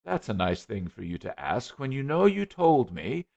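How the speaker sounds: rising, indignant retort; indignant snort. A narrator's reading. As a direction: snort it out, offended. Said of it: "snorted William".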